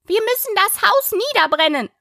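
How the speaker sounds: high-pitched, insistent rabbit cry; rabbit calling out. A narrator's reading. The rabbit urges the others to burn the house, calling out. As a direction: call it out in a high animal voice. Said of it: "rief das Kaninchen".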